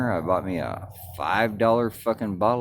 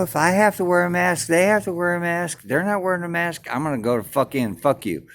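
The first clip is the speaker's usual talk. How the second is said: angry rant; 6 semitones higher, 4 dB louder than usual. Retold or imitated. Retold rant at the cop.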